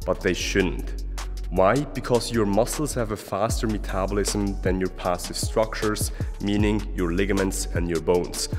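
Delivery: usual speaking voice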